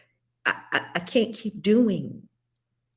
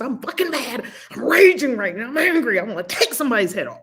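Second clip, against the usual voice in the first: loud, higher venting of fury into a mirror; mock rage demo; 3 semitones higher, 4 dB louder, faster than usual. Shown as a demonstration. Host showing listener how to admit rage, raised furious voice. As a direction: let the anger rip, half-acted. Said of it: "tell it to yourself in the mirror".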